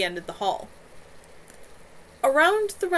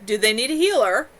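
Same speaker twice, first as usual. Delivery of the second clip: called back; 3 semitones higher, 9 dB louder than usual. A narrator's reading. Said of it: "a pleasant baritone called back".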